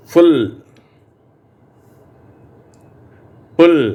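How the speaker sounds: ஃபுல், புல் with a short oo, spaced apart; short u sound. Shown as a demonstration. Teacher modelling the u words to echo, clear. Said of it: "ஊ என்ற உச்சரிப்பு".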